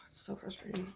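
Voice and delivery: whispered, weary frustration; a real exhausted whisper; frustrated whisper. Real, not acted. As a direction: breathe it out, fed up. Sighs, frustrated about boyfriend.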